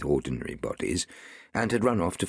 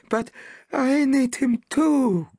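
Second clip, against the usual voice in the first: high, slow, plaintive villager's cry; 5 dB louder, slower than usual. A narrator's reading. Villager protesting to the Levantine, high and plaintive. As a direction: wail it in protest. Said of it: "cried the villager".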